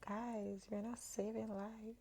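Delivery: quietly talking colleagues down; hushed reality check. Acted. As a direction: drop the volume right down to reason with the team. Mock calming of stressed team.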